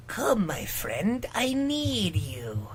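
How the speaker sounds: slow, breathy whisper; mysterious griffin. Acted. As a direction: hush it like an ancient creature. Reread griffin line in voice.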